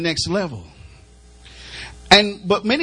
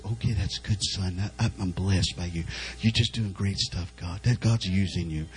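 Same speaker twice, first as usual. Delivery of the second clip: put-on much lower, much softer, murmured praise of a gentle old pastor; soft spiritual father; 9 dB quieter than usual. Preacher imitating his spiritual father's quiet praise, very low and soft. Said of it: "he talks like this".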